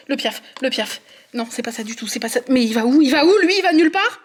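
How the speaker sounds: ordinary talk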